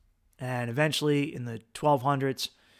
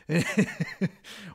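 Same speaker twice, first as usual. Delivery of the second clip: short laugh; 4 semitones higher than usual. Real, not acted. Laughing at his own joke.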